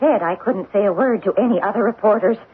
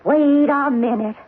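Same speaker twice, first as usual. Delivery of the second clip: ordinary delivery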